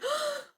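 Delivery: sharp high gasp; frightened gasp. Acted. Babaji startled by the tiger, sharp gasp.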